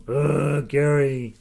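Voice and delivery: loud, drawn-out groan of complaint; mock exasperation. Viewer stuck with an earworm, mock-exasperated groan at the host. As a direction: groan the name out in playful annoyance.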